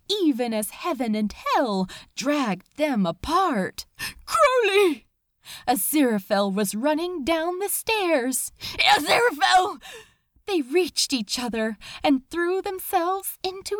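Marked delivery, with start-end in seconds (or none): 4.2-5.0 high, loud cry of a name; desperate call. Acted. Angel running down the stairs calling his love, desperate. 8.9-9.8 loud higher cry of a name; desperate call. Acted. Demon running up the stairs calling the angel, desperate.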